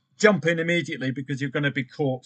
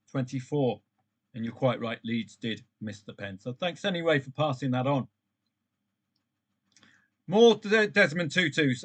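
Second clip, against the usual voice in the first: ordinary talk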